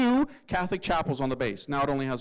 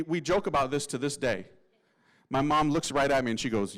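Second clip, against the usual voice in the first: ordinary delivery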